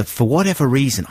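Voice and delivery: ordinary talk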